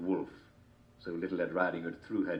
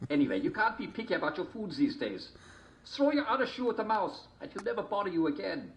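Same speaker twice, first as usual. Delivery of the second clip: high wolf voice; 12 semitones higher than usual. A narrator's reading.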